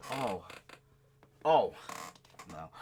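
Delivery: ordinary delivery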